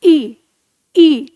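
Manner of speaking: ई drawn out clearly as a long front vowel; vowel demo. Shown as a demonstration. Teacher models ई for students, clear and deliberate. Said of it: "उच्चारित करके देखिए".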